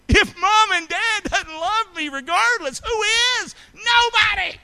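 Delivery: high, loud preacher's cry; preaching at full force. Real, not acted. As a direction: lift the room with a ringing plea.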